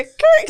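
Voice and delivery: creak noise. Retold or imitated. Imitating door creak.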